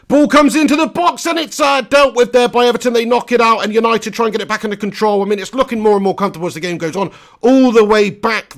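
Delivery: lifted commentary voice as the ball hits the box. Acted. Streamer calling the United attack, rising commentary. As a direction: call the cross into the box. Live play-by-play of match.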